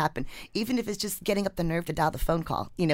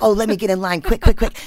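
loud, high, hurried eagerness; eager rush; 6 semitones higher, 13 dB louder than usual. Retold or imitated. Writer rushing to join the line, loud mock eagerness. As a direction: hurry yourself into the line. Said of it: "I was like".

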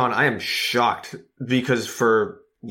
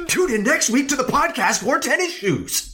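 high squeaky put-on voice; Robbie impression. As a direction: pitch it way up like a cartoon kid. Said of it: "impersonation of my impersonation of Robbie".

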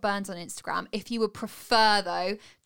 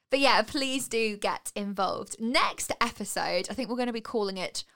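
ordinary delivery

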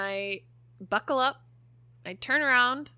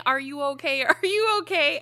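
retold scream; 5 semitones higher, 5 dB louder than usual. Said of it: "he's screaming at me".

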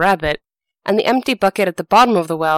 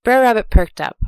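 usual speaking voice

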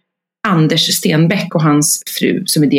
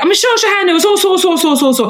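bossy directions; 13 semitones higher than usual. Retold or imitated. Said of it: "tvärilsk på honom".

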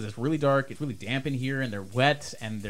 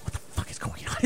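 soft, breathy disbelief, all whispered; stunned whisper; 9 dB quieter than usual. Acted. Crawling survivor meets the rescuer, whispering stunned. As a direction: whisper it, completely unready for this. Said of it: "he is completely not ready".

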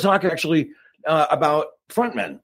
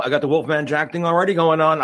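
ordinary talk

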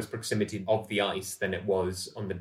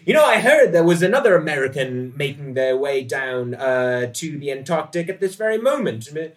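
higher, louder, swaggering ship's officer; rakish sailor William; 7 semitones higher, 7 dB louder than usual. Acted. William leaning on the bulkhead, chatting up Dorothy: high, loud, showy. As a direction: play the smug charmer name-dropping a famous friend.

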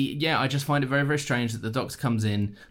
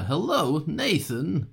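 higher, halting celebrity impression; a quick Walken voice, pitched up; Christopher Walken impression; 6 semitones higher, slower than usual. Guessing the famous impression, mimicking Walken. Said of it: "Christopher Walken's the one that's like".

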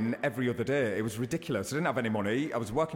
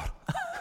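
high laugh; 3 dB quieter than usual. Real, not acted. Laughs after his murder line.

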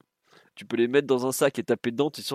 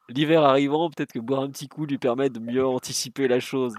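laughing through a joke; chuckling while joking; 3 semitones higher, 6 dB louder than usual. Real, not acted. Joke after cirrhosis quip.

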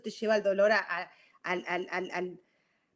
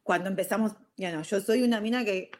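normal talking voice

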